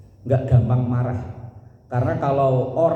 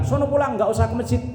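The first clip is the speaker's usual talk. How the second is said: put-on higher-pitched telling-off of a child; mock scolding; 7 semitones higher, faster than usual. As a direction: act out snapping at a kid. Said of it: "bocah kalau misalnya dibentak".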